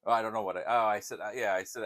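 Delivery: usual speaking voice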